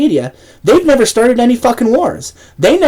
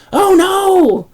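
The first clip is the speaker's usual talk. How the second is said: mock outrage; 11 semitones higher than usual.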